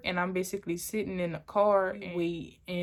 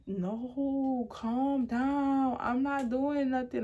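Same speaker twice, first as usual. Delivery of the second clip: higher-pitched, mildly scared pleading; retold scared plea; 5 semitones higher, much slower than usual. Girlfriend placating her angry boyfriend, retold as mildly scared. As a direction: try to calm him, a touch of fear. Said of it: "Like, I'm scared".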